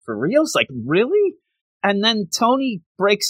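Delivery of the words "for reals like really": mock disbelief. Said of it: "he's like".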